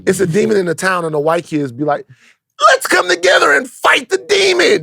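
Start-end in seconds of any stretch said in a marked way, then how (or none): 2.7-4.8 high, slow, rallying cry shout; hammed-up heroic kid.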